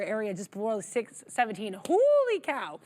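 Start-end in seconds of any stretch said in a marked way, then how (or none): 1.9-2.8 higher, louder excited rush; excited exclaiming. Real, not acted. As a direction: get hyped over the chart. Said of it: "so I stop sounding like an auctioneer".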